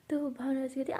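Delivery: plain reading voice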